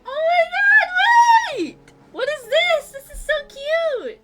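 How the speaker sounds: squealing excitement. Real, not acted. Streamer seeing a baby bird on screen, squealing with delight. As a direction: melt over something adorable.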